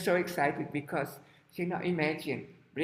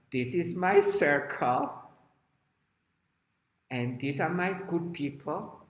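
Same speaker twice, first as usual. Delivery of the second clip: possessive declaration; 3 semitones higher, 4 dB louder than usual. Acted. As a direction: stake a small child's claim. Voicing a small child's possessive claim.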